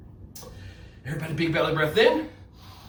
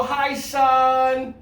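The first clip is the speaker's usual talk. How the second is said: cheery call; 6 semitones higher, 5 dB louder than usual. Shown as a demonstration. Leads kids calling a greeting.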